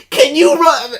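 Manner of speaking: high, loud mock caller; prank caller voice. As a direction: blurt it like a giggly prank caller.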